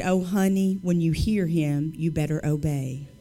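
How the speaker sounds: a low, knowing hint of an old lady; sweet older lady. Immigrant lady counseling the speaker, lightly voiced sweetness. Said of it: "sweet little immigrant lady with an accent".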